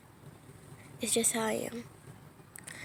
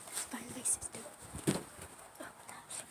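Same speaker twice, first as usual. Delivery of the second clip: whispering; 17 dB quieter than usual. Real, not acted. Sneaking off to spy.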